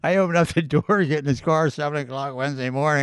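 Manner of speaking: ordinary talk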